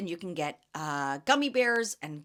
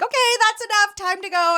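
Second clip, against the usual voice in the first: much higher, loud mock cut-in; imitated brisk intervention; 13 semitones higher, 10 dB louder than usual. Female companion pulling the cranky chemist away, imitated loud interruption. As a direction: play a companion loudly hauling someone away. Companion cutting off argumentative man.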